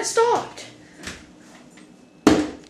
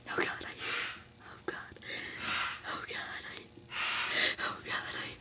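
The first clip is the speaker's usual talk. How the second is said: hushed terrified whisper; whisper, hiding from the killer; whispering under a desk; 12 dB quieter than usual. Acted.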